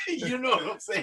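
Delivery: high, soft laughing question; giggling through the words. Real, not acted. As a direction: laugh it off after a blunt line. Laughs after blunt remark.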